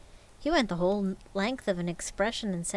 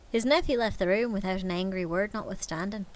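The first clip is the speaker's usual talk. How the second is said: usual speaking voice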